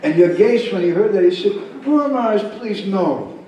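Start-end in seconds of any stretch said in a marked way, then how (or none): 1.9-3.4 high pleading objection; urgent, respectful refusal; alarmed protest. Acted. Disciple objects to the guru's order.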